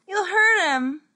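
high, loud pleading cry; tearful plea. Acted. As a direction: close to tears. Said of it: "nearly in tears".

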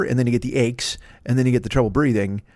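ordinary delivery

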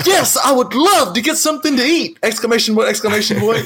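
high, gushing fake enthusiasm; hammed-up thrill with the punctuation spoken aloud; mock over-excitement. Co-host mocking overeager texters to his friends, hammed-up excitement. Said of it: "just because I'm not like".

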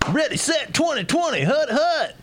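high, shouted snap count at the line; quarterback call. Acted. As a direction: bark the play like a quarterback.

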